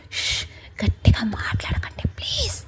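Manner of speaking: soft urgent whisper; hushed whisper. A narrator's reading. Hero begging his girlfriend to keep quiet, whispered. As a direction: shush her in a breathy hurry. Hushing her so mother won't hear.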